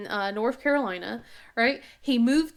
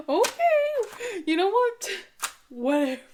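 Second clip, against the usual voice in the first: laughing disbelief; 9 semitones higher, 4 dB louder, slower than usual. Real, not acted.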